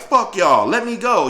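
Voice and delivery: loud high yell to break free; held teen cursing the men, angry; furious retort. Retold or imitated. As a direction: spit it at them. Pinned teen yells at captors.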